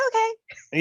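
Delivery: high-pitched playful okay; easily lured okay. Retold or imitated. Speaker lured in by a hot dog, high playful okay. Acting out being lured with food.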